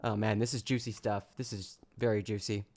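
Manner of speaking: ordinary delivery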